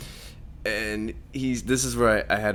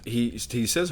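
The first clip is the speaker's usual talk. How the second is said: ordinary talk, nothing marked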